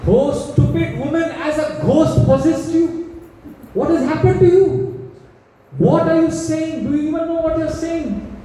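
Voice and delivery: high, rising furious rebuke; angry king. Acted. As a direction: scold her in disbelief and rage.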